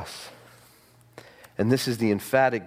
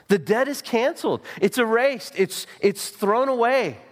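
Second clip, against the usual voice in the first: high, fervent preacher's climb; soaring pulpit proclamation; impassioned preaching; 10 semitones higher than usual. Acted. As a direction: lift it up to the congregation with fervor. Preaching at full force.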